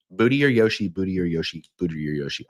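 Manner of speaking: playful patter trailing down in pitch; fading playful repetition. Shown as a demonstration. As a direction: repeat it, stepping down each time. Fun phrase repeated, winding down.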